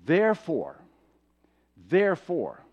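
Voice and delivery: each THEREFORE set apart by a long pause; emphatic repetition. Acted. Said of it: "I'm really making an emphasis here".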